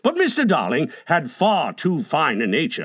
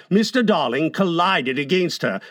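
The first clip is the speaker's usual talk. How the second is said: ordinary delivery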